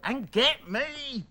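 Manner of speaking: slow, high, drawn-out order; petulant, building insistence; angry demand. A narrator's reading. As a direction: stretch out the demand imperiously. Angry son demands bananas.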